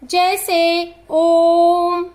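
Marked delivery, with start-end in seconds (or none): 1.1-2.0 ओम held very long and slow, like a call; ओम with a long calling vowel; drawn-out pluta vowel. Shown as a demonstration. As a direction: stretch the vowel in ओम to three beats. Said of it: "इनका प्रयोग अधिकतर पुकारते समय".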